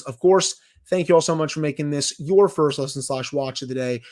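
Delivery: ordinary delivery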